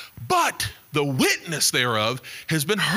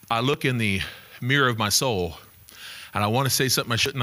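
normal talking voice

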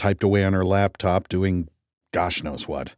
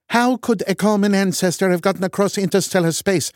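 scornful Norwegian; 12 semitones higher than usual. A narrator's reading. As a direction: sneer it in a Norwegian accent. Loken arguing.

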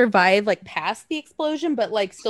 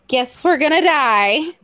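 slow, louder, higher mock doom; hammed-up resignation; 4 semitones higher, 6 dB louder, slower than usual. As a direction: draw it out with playful fatalism. Same resigned line, hammed up.

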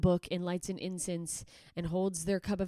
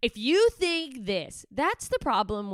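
slow, louder mock lecture, dragged out; mocking quote; 6 semitones higher, 6 dB louder, slower than usual. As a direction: sneer out each word slowly like a smug poster. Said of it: "they're like".

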